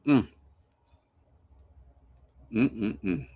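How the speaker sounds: humming through a mouthful; mouth full. Real, not acted. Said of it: "trying not to talk with my mouth full of whiskey".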